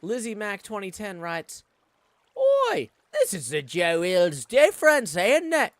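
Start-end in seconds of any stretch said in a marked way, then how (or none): 2.4-5.7 loud, high hammed-up Australian accent, half Scottish. Streamer reading a viewer's chat aloud in a loud mock Australian accent. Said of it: "what kind of Australian accent that is".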